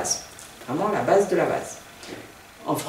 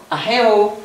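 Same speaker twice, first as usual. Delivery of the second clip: AHEO with a higher, held stressed middle; stress inside AHEO; 3 semitones higher, 4 dB louder than usual. Shown as a demonstration. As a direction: lift and lengthen the middle of AHEO. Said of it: "l'accent tonique".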